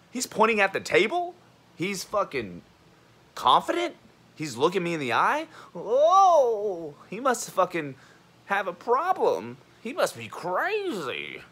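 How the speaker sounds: high, loud mimicry of scandalized onlookers; hammed-up high-pitched outrage; mock alarm. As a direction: exaggerate their shock, pitch way up. Mimicking people shocked by him.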